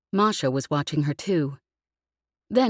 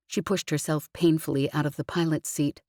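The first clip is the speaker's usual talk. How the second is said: plain reading voice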